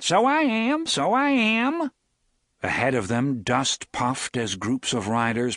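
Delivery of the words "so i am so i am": much higher, loud, hammed-up Hambry accent; exaggerated Hambry accent. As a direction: ham up the Hambry accent, slowing on the second. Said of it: "in a wildly exaggerated Hambry accent".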